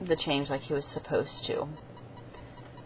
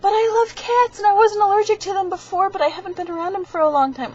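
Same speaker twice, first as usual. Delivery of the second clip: much higher, louder pleading voice, speeding up at the end; whiny friend imitation; 8 dB louder than usual. Dakota defending his love of cats, high whiny imitation. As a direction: whine it like a hurt kid. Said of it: "And he's like".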